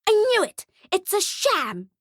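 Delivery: very high, indignant outburst; high-pitched child tantrum; outraged brat. Acted. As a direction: accuse him like a furious little girl. Girl accuses Santa of faking.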